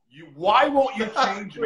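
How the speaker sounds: loud, high-pitched angry demand; mad at God. Retold or imitated. Inmate blaming God in his cell, angry yell. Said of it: "now I'm mad".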